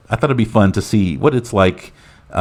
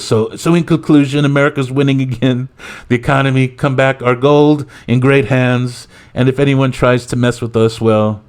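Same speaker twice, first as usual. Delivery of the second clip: higher-pitched mock presidential sign-off; Donald Trump; 6 semitones higher, 4 dB louder than usual. President giving closing remarks, boastful parody. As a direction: parody politician's triumphant conclusion. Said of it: "So Trump, uh, he says".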